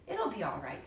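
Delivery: soft reassurance. A narrator's reading. Comforting friend.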